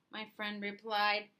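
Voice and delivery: ordinary delivery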